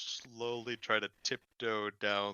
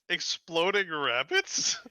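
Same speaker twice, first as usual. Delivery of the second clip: slow, raised, questioning disbelief; incredulous; 5 semitones higher, 3 dB louder, slower than usual. Acted. Clerk questioned about exploding rabbits, incredulous. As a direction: echo it slowly in baffled disbelief.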